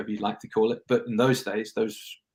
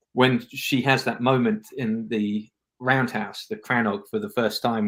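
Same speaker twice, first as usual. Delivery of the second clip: ordinary talk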